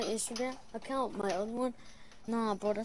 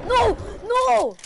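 high panicked yells; shout; 12 semitones higher, 11 dB louder than usual. Real, not acted. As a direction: yell no in alarm. Getting killed in game.

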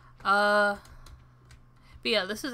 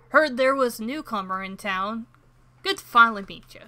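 a lightly voiced old sea dog, loud then trailing off; hearty fisherman greeting the newcomer; gruffer fisherman voice; 8 dB louder than usual. Player retrying the fisherman's voice, mild gruff sailor. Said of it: "That voice is not fitting his face".

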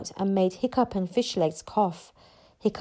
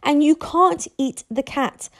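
exasperated telling-off, pitched up; losing calm; 6 semitones higher than usual. A narrator's reading. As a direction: forbid it as patience runs out. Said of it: "feeling all his calmness deserting him".

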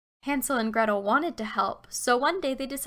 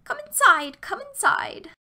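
put-on high, coaxing old lady voice; eager old woman; 11 semitones higher, 5 dB louder than usual. A narrator's reading. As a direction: beckon them in, rising higher. Witch beckoning the children.

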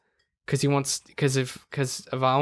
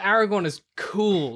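ordinary talk

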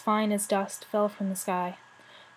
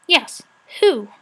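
sharp, louder, higher jab of a question; sharply; 4 semitones higher, 8 dB louder than usual. A narrator's reading. Rat sharply mocks the brownie girl, louder and higher. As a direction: cut in sharply. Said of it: "asked Rat sharply".

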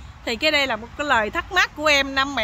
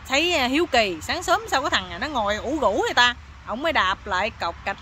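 normal talking voice

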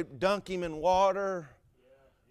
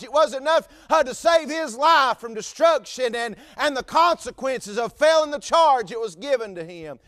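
rising sermon cadence, a bit louder; preaching; 5 semitones higher, 5 dB louder than usual. Acted. Preacher tells the church of the jailer, fervent preaching. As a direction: preach it with building fervor.

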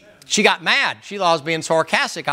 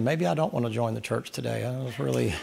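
much softer, lower mock thinking aloud; imagined private thought; 8 semitones lower, 10 dB quieter than usual. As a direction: murmur it like a churchgoer's private doubt. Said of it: "Miss Linda's thinking".

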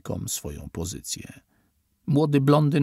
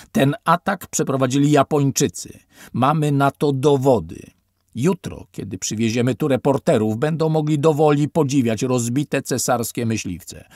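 loud, higher, domineering; aggressive officer; 4 semitones higher, 6 dB louder than usual. A narrator's reading. Officer lecturing survivors on the fake attack, loud and domineering. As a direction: hammer the lie into them.

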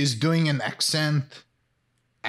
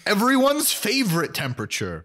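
mock announcer; 7 semitones higher than usual. Mocking Becky's water.

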